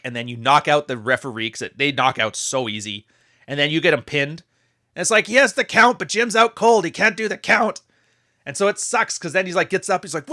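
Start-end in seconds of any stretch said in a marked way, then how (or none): 4.9-7.8 play-by-play. Pretend. Play-by-play of imagined match.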